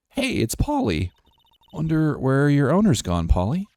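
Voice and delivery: high, sweet talk to a pet; Maya. Acted. Character greeting Polly the parrot, high friendly voice. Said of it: "a little higher".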